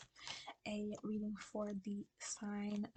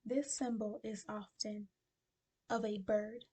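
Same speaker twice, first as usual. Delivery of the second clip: ordinary talk, nothing marked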